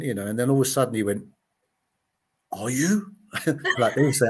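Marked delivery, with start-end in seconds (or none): none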